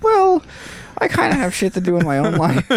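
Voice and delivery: high airy brush-off; dismissive. Retold or imitated. To hurt friend, an airy high brush-off. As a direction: wave them off breezily. Acted dismissive reply in skit.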